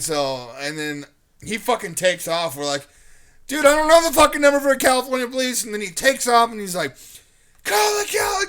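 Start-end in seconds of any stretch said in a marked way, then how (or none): 3.5-5.6 high, loud retold protest; exasperated yelling. Scared teen answering stranger's demand for a number, exasperated. 7.6-8.4 high-pitched yell, cut short; retold frantic shout. Stranger running off still calling for police, frantic. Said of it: "he just kept repeating".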